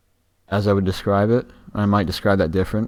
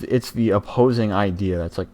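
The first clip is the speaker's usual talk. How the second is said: ordinary talk, nothing marked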